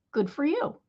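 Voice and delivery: sincere, pleased well done; mean it, a real congratulation; honest heartfelt good for you; genuine praise. Shown as a demonstration. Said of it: "in a genuine, "Yay, you" spirit".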